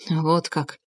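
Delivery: soft, subdued reply; faint smile, low energy; weakly smiling. A narrator's reading. Tarasov taking in the news, weakly amused. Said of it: "слабо улыбнулся Тарасов".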